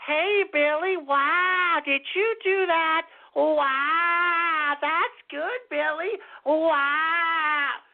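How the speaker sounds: put-on high, sing-song praise as if to a small child; mock the way people coo at a slow kid; condescending sweet talk. Mocking how people talk down to Billy, sugary child praise. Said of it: "When people are talking to you like".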